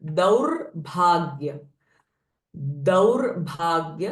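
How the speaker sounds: "दौर्भाग्य" with a clear r closing दौर्; r before bhagya. Shown as a demonstration. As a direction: separate "दौर्भाग्य" after the r, then aspirated भाग्य. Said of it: "before bhagya, r sound will come". ("दौर्भाग्य" in two careful parts, r sounded before bh)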